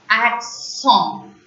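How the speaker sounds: "Accent" as ak-SON, falling on the nasal ending; French pronunciation. Shown as a demonstration. Teacher models it for learners to repeat, French "Accent".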